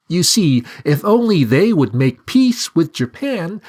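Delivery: high scheming talking bird; persuasive bird. A narrator's reading. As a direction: coax him with sly reasoning.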